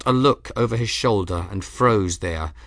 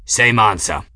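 louder gruff growl; 7 dB louder than usual. A narrator's reading. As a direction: grumble it back curtly. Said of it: "the man growled".

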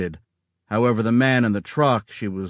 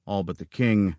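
ordinary delivery

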